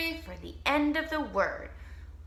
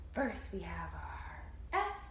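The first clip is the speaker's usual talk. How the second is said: whisper; 5 semitones lower, 5 dB quieter than usual. Shown as a demonstration. Quiet recap of spelling.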